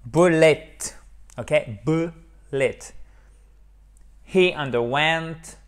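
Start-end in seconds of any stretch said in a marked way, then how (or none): 0.1-0.8 "Bullet" ending almost in an open e, "let"; "Bullet" pronounced "BULL-ett", firmly; near-E ending. Shown as a demonstration. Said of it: "En fait, c'est presque un E". 1.9-2.8 "Bullet" broken in two, "bu... let", with an open e; "Bullet" in syllables, last vowel almost E; syllable-by-syllable demo. Shown as a demonstration. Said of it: "c'est presque un E".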